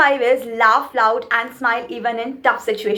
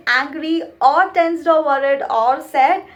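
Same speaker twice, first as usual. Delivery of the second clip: usual speaking voice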